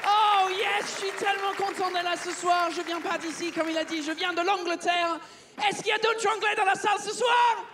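high-pitched, hammed-up English accent; over-the-top English-accented stage voice; fake English accent. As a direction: greet the crowd in a put-on English accent. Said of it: "je vais arrêter de vous parler avec cet accent de merde".

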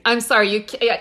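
ordinary talk, nothing marked

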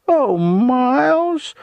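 very high, loud, drawn-out simper; venomous simpering. A narrator's reading. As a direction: simper it slowly with venom underneath. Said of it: "said then in a simpering voice".

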